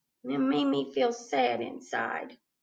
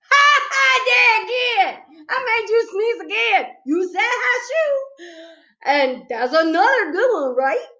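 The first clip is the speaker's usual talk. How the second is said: much higher, loud squealing glee; gleeful; 12 semitones higher, 8 dB louder than usual. A narrator's reading. Girl squealing with glee at her joke to Warren. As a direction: crow with delight.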